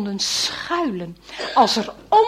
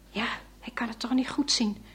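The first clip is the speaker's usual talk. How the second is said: whisper; 11 dB quieter, faster than usual. A narrator's reading. Quick whispered aside.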